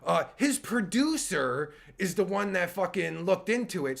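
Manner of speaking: normal talking voice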